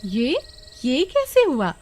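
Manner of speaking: high, astonished question; shocked. Acted. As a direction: gasp it out in disbelief, pitch jumping. Vimla shocked at long hair. (चौंककर, ऊँची आवाज़ में)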